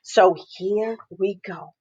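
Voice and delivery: plain reading voice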